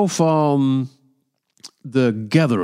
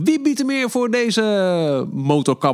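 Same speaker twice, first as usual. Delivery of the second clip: auctioneer; 9 semitones higher than usual. Retold or imitated. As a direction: call out bids like an auctioneer. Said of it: "ging net doen of we".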